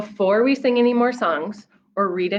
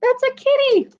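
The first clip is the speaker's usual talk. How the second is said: high squeaky kitty; delighted; 6 dB louder than usual. Acted. Reader delighting child with the kitty excavator.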